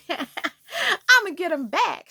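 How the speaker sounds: slow, drawn-out vow after a laugh; gleeful vow. Acted. Girl relishing payback on her classmate, gleeful drawl. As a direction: stretch it out with playful relish.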